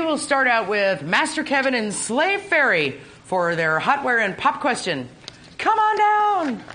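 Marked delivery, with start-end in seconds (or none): 5.6-6.6 high, slow, booming host call; game show host. Pretend. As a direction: stretch it out like a game show announcer.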